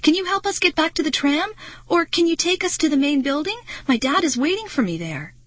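high, pleading questions; anxious, imploring child; begging. A narrator's reading. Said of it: "I begged".

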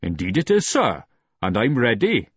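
put-on light Welsh accent, joking. A narrator's reading. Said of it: "the Welsh accent was very pronounced".

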